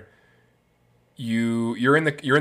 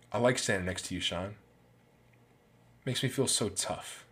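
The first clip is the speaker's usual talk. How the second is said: cold quiet jab; 3 semitones lower, 9 dB quieter than usual. Retold or imitated. Quoting a cold parting line.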